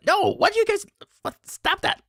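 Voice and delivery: high, squawking objection; flustered protest. Real, not acted. Streamer telling chat to stop, high flustered protest. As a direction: pitch it way up, telling them to stop.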